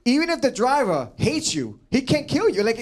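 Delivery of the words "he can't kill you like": ordinary delivery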